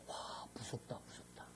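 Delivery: hushed whisper of mock fear; fearful whisper. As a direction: breathe it like a scared churchgoer. (겁먹은 속삭임)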